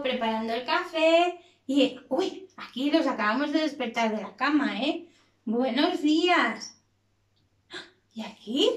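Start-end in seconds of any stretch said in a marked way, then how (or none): none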